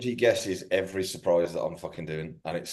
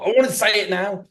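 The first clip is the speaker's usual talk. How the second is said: eager blurt; 11 semitones higher, 3 dB louder than usual. Retold or imitated. Quoting own eagerness.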